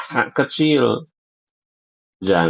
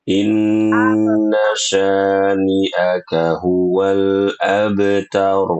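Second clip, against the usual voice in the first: very slow chant of the verse; slow model recitation; much slower than usual. Shown as a demonstration. Teacher modelling the verse to repeat. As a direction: recite it slowly for the student to copy. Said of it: "Nah, begitu".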